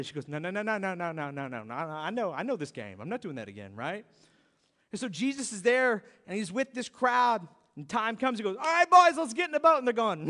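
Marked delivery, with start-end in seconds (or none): 0.3-3.7 low mock child refusal, no no no; playing a wary little girl, lightly; daughter refusing. Little daughter refusing her dad's yank, low and wary. 8.6-9.8 louder, higher hearty call; rally the boys like a captain. Retold or imitated. Jesus calling the disciples into the boat, loud and hearty.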